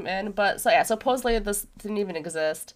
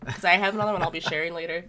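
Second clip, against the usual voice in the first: ordinary talk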